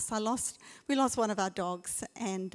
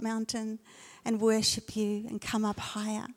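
prayer. Acted. Leads a prayer.

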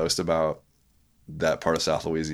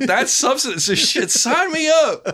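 high, louder, drawn-out delight; mock eager; 5 dB louder, slower than usual. Joking about cheap housing, high mock eager. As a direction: jump at it jokingly. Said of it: "I was like".

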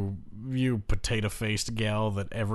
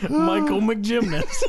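laughing; 11 semitones higher, 5 dB louder, slower than usual. Real, not acted. Laughing echo of impression.

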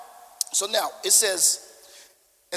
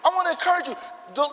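ordinary delivery

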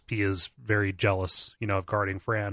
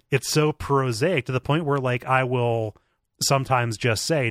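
usual speaking voice

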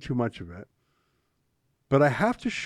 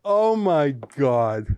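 stunned; 5 dB louder, much slower than usual. Real, not acted. Host reacting to the sketch.

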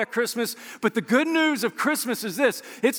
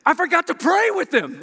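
higher, animated quote of an excited friend; retold excitement; 7 semitones higher, 4 dB louder than usual. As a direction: blurt it out excitedly, imitating your buddy. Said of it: "he was so excited. He's like".

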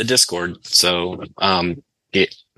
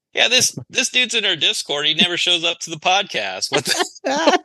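higher, indignant rant; mock outraged; 8 semitones higher than usual. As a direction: act baffled and annoyed. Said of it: "I'm like".

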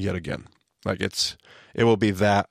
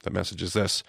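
usual speaking voice

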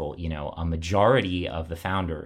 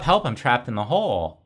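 mild mock yell, higher and louder; retold scream up; 6 semitones higher, 5 dB louder than usual. Trapped man yells up to his passing friend, mock shout. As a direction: call up out of the hole, half-acted. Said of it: "he screams up".

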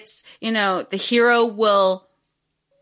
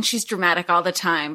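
ordinary delivery

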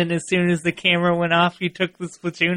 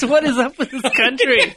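mock indignant talk radio rant; conservative radio host; 7 semitones higher than usual. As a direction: play an outraged radio pundit, laughing. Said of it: "Sound like a conservative radio host".

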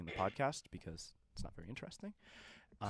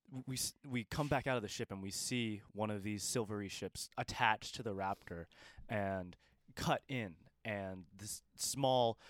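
game master narration; 5 dB louder than usual. Acted. Game master describes the scene.

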